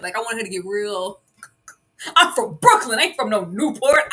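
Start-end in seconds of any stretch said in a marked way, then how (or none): 2.2-4.0 loud, high Brooklyn clapback. Retold or imitated. Host acting out the line she wished Mama Scott said, loud and sassy. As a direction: put a little stank on it, loud.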